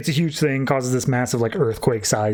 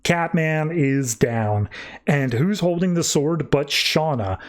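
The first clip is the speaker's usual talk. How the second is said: ordinary talk, nothing marked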